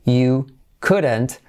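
very slow, separated teaching pronunciation; slow word-by-word model. Shown as a demonstration. Teacher modelling the English phrase for learners, slow and separated. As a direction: stretch each word out for learners.

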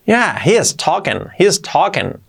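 conversational tune on "he is talking"; natural intonation. Shown as a demonstration. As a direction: lift the pitch on "he is", drop on "talking". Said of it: "Entonación".

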